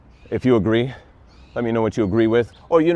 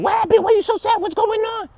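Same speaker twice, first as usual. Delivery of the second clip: very high squeaky mock AI voice. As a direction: pitch the AI way up into a squeak.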